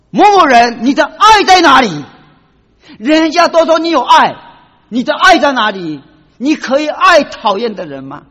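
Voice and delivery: loud, high, rebuking call; voice of God challenging. Retold or imitated. God confronting the doctor about his missing love, loud rebuke. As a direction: thunder the question down on him. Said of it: "神给我说".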